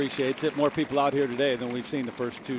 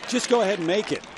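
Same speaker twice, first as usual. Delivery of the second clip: excited; 8 semitones higher, 6 dB louder than usual. Real, not acted. Urging the putt in.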